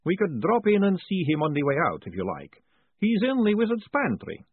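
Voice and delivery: brisk, high, chatty academic; high professor voice. Acted. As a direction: lift the pitch for the cheerful professor. Professor Pelk speaking.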